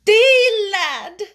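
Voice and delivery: a mother choked with feeling; tremulous. A narrator's reading.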